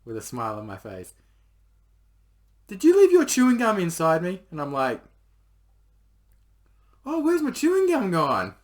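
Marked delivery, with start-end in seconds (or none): 2.7-4.4 high, loud accusing shout; accusing yell. Retold or imitated. Girlfriend accusing in story. 7.1-8.6 high, loud fake innocence; mock innocence. He plays innocent.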